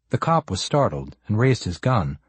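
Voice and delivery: plain reading voice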